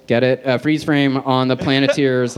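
ordinary talk, nothing marked

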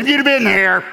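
high, loud scolding yell, half laughing; a sister's shrill reproach, very high and loud; scolding Martha. Retold or imitated. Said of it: "Martha said".

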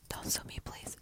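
hushed, secretive whisper. Pretend. To listeners, hiding from [name], a whisper. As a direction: murmur it so nobody overhears. Begging not to be sued.

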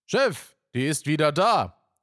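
high, loud call to the boss. Acted. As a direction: shout the news over to the boss. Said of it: "ruft Boris". (laut und hoch nach dem Chef rufen)

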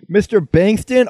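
high, loud, drawn-out call of the name; calling out a teacher. Retold or imitated. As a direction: stretch the name out as a mock greeting. Greeting the sub teacher by name.

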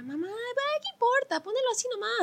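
casual brush-off to mom. Retold or imitated. Daughter waving off her mom's fuss, high and carefree. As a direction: wave mom's worry away, lightly. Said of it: "yo le decía".

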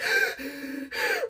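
high gasping mock sob; imitated hyperventilating crying. Newlywed calling her friend in a panic, hyperventilate crying. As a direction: gasp as if hyperventilating through tears. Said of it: "I immediately start hyperventilate crying".

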